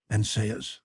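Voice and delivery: plain reading voice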